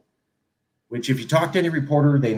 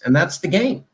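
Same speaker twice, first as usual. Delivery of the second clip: normal talking voice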